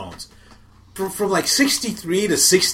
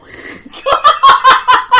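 very high, loud laughter; big laugh; 8 dB louder than usual. Real, not acted. Co-host cracking up at country jab, big laugh.

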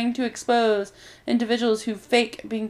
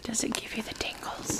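soft unvoiced tingles question; ASMR whisper; 11 dB quieter than usual.

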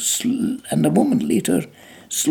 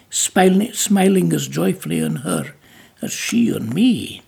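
ordinary delivery

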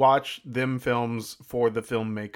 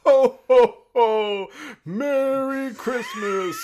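Santa voice; 12 semitones higher, 6 dB louder, much slower than usual. Acted. [name] plays Santa.

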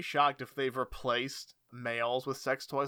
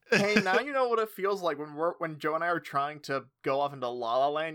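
ordinary delivery

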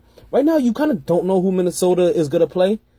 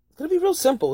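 high excited; 12 semitones higher than usual. Real, not acted. Repeated phrase, higher.

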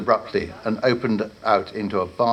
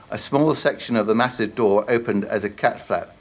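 ordinary delivery

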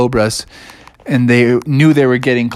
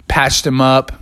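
ordinary delivery

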